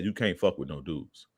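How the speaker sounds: ordinary talk, nothing marked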